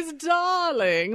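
high, drawn-out mock-foppish endearment; ridiculous over-the-top socialite greeting; affected Bruce Wayne. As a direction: swoop through it like a camp dandy. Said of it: "affected way of speaking".

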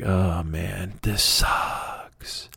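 low, soft, dejected groan; acted glum boredom, low and quiet; bored George. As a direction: sigh it out, fading away. George bored with his stick.

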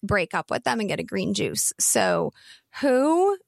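put-on creaky valley-girl fry voice; vocal fry. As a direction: let the words sink into a creaky fry. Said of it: "like, vocal fry for this".

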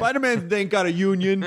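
mock union-man banter; crane worker.

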